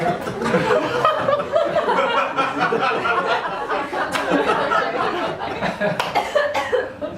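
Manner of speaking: long high breathy laughter. Real, not acted. Laughing as she admits checking the porn.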